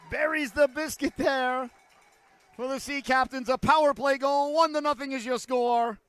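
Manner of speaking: very high, loud shouted goal call; excited goal call. Real, not acted. Broadcaster calling a power play goal, excited goal call. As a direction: shout the goal, drawing out the big words.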